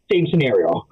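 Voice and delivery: ordinary talk, nothing marked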